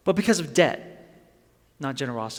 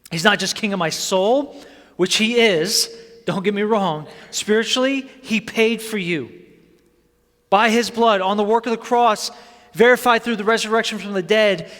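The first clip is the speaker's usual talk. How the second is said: high, loud, impassioned sermon; full-force preaching; 6 semitones higher, 6 dB louder than usual. Acted. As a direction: drive each phrase out loud and high to the congregation.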